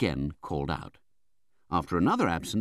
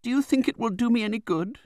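high, anxious question; plaintive young mother; 4 dB louder than usual. A narrator's reading. Young widow appeals to the aunt, plaintive. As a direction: cry it helplessly, high in pitch. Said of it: "cried my mother".

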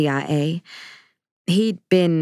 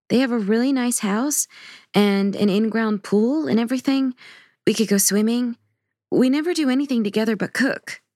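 put-on higher, coaxing teenage girl voice; teen daughter pleading; 7 semitones higher than usual. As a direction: coax dad into coming along.

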